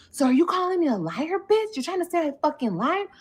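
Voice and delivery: high-pitched mock challenge; retold quarrel, pitch swooping up; confrontational. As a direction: act out an offended accusation.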